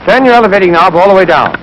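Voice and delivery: normal talking voice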